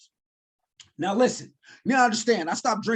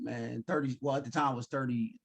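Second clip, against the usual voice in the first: ordinary delivery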